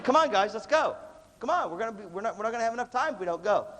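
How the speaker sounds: retold rallying call; urging the group. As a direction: hurry the guys along.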